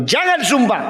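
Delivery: God commanding his people not to swear; divine command. Retold or imitated. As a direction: lay down the law. Quoting God's command.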